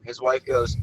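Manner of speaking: normal talking voice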